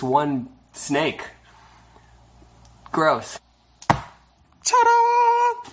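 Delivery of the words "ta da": high, bright reveal call; excited ta-da. Real, not acted. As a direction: present it like a magician. Reveal of the sprinkle pile.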